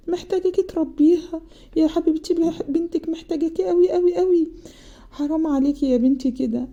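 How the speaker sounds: high, pleading mother's plea, close to tears; tearful pleading mother. Retold or imitated. Mother pleading with her depressed daughter, high tearful plea. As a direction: beg your daughter like a mother fighting back tears. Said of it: "وهي بتعيط".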